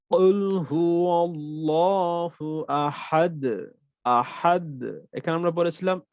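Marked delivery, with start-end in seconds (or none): 0.1-4.7 slow recitation with a big echo on Ahad; Quran recitation. Shown as a demonstration. Teacher demonstrating big qalqala at the word's end. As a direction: chant slowly, bounce hard on the final d. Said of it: "যেমন".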